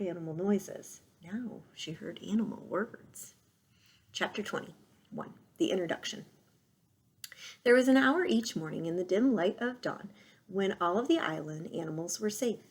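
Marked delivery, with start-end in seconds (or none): none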